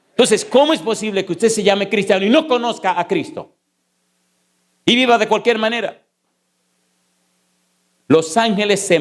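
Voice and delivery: rising, urgent sermon voice that drives each question home; fervent preaching. Acted. Pastor urging the congregation to know Christ, fervent preaching. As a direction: preach to the congregation with full conviction.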